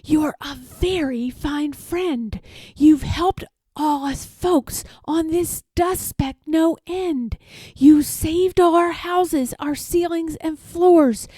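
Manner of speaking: a small grateful voice calling up; lightly voiced tiny mayor, thankful; tiny speck voice. A narrator's reading. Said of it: "came the voice".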